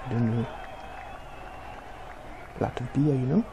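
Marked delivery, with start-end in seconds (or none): none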